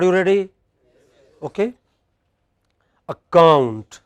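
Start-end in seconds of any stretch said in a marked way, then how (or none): 3.1-4.0 ACCOUNT with a loud, long second syllable; light a-, booming -COUNT in Account; final-syllable stress. Shown as a demonstration. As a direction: hit the COUNT in Account hard. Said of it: "words which have a stress on".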